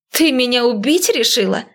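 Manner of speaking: high-pitched indignant grumble; grumbling. A narrator's reading. Woman let out of the trunk complains to her driver, grumbling. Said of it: "проворчала она".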